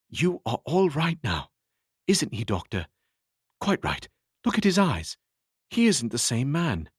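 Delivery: high, eager friend's voice; cheerful friend. Acted. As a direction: beam at the recovering patient. Tom voiced at the bedside.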